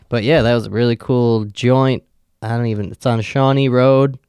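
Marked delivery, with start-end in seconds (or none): none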